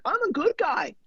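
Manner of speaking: high-pitched self-justifying protest; hammed-up plea of innocence; pleading to reason. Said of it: "you're trying to reason".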